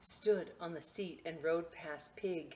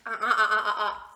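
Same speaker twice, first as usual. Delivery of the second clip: loud open laughter at her own joke; laughing; 4 semitones higher, 12 dB louder, faster than usual. Real, not acted. Librarian laughing at her joke for the kids.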